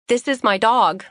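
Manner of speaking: politely strained, holding back irritation; sweetly restrained with a little edge; forced pleasant. Acted. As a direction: keep it pleasant through gritted teeth. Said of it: "trying to keep my voice pleasant".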